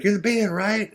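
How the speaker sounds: high, friendly rocker voice; friendly rock star. Retold or imitated. [name] asking the band what they're up to, high voice.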